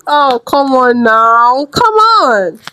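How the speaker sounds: drawn-out, exasperated come on; slow disbelieving protest, pitch jumping up. Real, not acted. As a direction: groan it out like you can't believe it. Exasperated at her own example.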